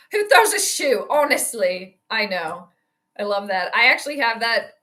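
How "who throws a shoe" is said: indignant movie quote. Retold or imitated. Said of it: "I have that queued up".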